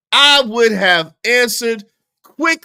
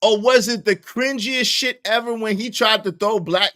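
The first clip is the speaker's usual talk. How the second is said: usual speaking voice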